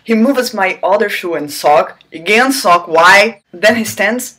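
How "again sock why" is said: mock exasperation. Mock complaint at the repetition.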